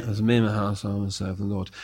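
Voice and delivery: low, soft, disengaged recital; flat rote reading. Shown as a demonstration. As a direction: read it like words on a plaque.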